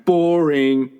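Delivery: mock-bored, stretching out the word; bored rejection. Older woman turning down the young man's offer, drawn-out boredom. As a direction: imitate a woman unimpressed, drawing it out.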